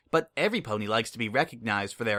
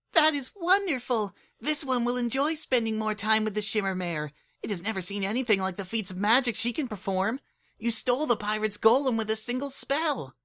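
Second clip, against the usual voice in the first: put-on much higher, earnest little changeling kid; Kukri's voice; 11 semitones higher than usual. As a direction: speak up like an eager, caring child. Kukri speaks.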